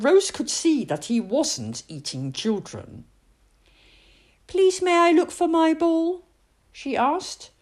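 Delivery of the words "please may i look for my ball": put-on much higher, louder small girl; high child, politely asking; little girl's voice.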